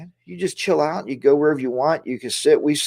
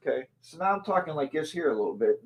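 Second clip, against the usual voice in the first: faint, off-mic, much softer; turned away from mic; 2 semitones higher, 10 dB quieter than usual. Shown as a demonstration. Vlogger turns from camera to show muffled audio, off-mic. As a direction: talk with your back to the camera mic. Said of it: "if I'm talking like this".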